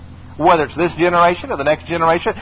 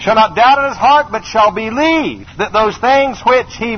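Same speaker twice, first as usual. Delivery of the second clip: preaching at full force; 4 semitones higher than usual. Acted. Preacher commanding the mountain before the congregation.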